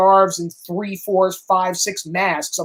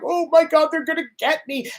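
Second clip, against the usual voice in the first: high, hammed-up mock panic; mock cowering; 8 semitones higher than usual. Mocking a cowering president in mild mock panic. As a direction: play a scared president, mockingly. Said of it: "cowering Donald Trump going".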